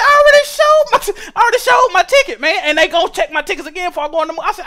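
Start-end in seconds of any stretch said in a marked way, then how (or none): 0.0-2.6 high, loud, cracking complaint; whiny outrage. Retold or imitated. To the ticket checker who asks again, high whiny outrage. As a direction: whine it like you're about to cry.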